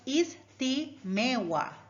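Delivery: "istimewa" split slowly into is-ti-me-wa; syllable by syllable. Shown as a demonstration. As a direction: say "istimewa" one syllable at a time. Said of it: "Four syllable in the word".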